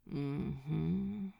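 drowsy low affirmative hum; sleepy hum. A narrator's reading. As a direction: hum it half asleep. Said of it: "Crowley hummed, half asleep".

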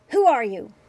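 very loud demanding shout; angry demand. A narrator's reading. Old man confronting Bashir at grave, loud demanding shout. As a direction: bark the question at him.

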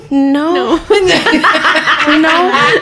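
high laughing no; laughing denial. Retold or imitated. Denying while laughing.

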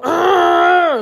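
high-pitched mock tantrum noise; angry child growl. Daughter getting mad when told off, mock tantrum growl. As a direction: imitate a mad toddler's wordless cry. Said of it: "she be getting mad".

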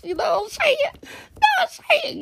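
very high, fast, excited squeal; hyped up. Retold or imitated. Telling the niece about cologne for bro, squealing excitedly. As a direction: squeal it with glee.

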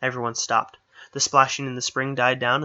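ordinary delivery

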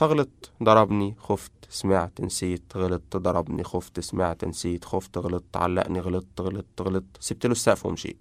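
very soft, low recital of hurts; hushed, withdrawn listing; haunted recollection. Acted. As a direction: let each word drop quietly, one by one. Girl recalls being beaten, leaving home.

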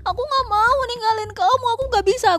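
sappy pleading in a mocking falsetto; mocking clingy admirer. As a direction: ham up a needy suitor begging. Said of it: "Semakin lo ngeklingi".

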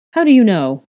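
high, loud challenge; demanding. A narrator's reading. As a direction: demand an answer forcefully. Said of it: "Gryffindor demanded".

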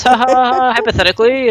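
high fake laugh into the word; mock covering laugh. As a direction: imitate someone laughing off a suspicious question. Acting out a cover-up question.

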